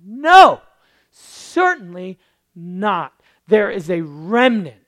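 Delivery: loud, emphatic No; Paul's emphatic denial. Acted. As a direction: proclaim it forcefully.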